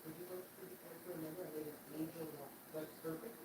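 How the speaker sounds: soft. Real, not acted. Talking to partner softly.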